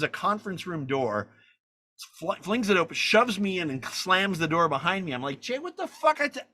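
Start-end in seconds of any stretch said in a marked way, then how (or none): 5.4-6.4 high-pitched startled outburst; shocked protest. Retold or imitated. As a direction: blurt it out in disbelief. Retold shock at being shoved.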